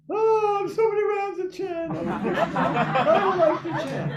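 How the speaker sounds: high whiny mock complaint; whiny devotee. Mocking a devotee who hates chanting, high whine.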